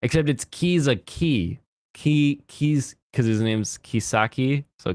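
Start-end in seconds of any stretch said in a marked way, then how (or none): none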